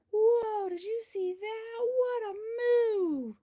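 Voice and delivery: loud, high, slow cheers of amazement; excited cheering. Acted. Cheese cheering a friend's move with loud awe.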